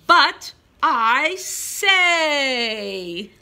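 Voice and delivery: slow, loud, drawn-out mock cry; hammed-up wail. Teacher reading to pre-K kids, slow mock cry. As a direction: wail it out like a sad child in the story. Story line read with big emotion.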